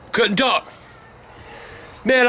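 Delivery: ordinary delivery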